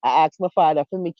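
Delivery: usual speaking voice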